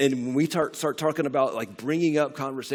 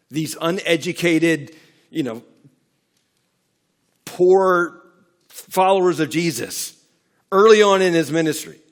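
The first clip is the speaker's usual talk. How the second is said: rising, louder preacher's build; preaching; 3 semitones higher, 8 dB louder than usual. Acted. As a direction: climb toward the big point.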